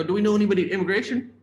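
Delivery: fast, high, loud rush of a question; retold himself pitching the idea, high and loud; eager question. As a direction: blurt it out in excitement. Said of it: "I go".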